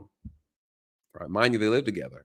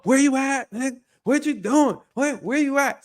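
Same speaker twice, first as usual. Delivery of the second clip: shrill-pitched hammed-up nagging; imitating the nagging girlfriend; 11 semitones higher than usual. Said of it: "So she".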